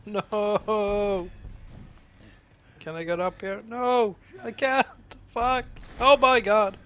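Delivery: panicked high screaming; terrified horror victim. Real, not acted. Being chased and attacked.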